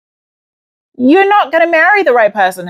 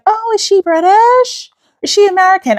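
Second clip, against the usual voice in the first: high-pitched mock curiosity; nosy questioners; 11 semitones higher than usual. People asking about her accent, high mock curiosity.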